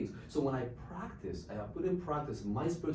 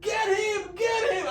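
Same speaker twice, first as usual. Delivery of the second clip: much higher and loud get him; screaming; 10 dB louder than usual. Retold or imitated. Old lady screaming to neighbours to catch him, high loud yell. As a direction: yell for someone to grab him. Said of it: "she started screaming".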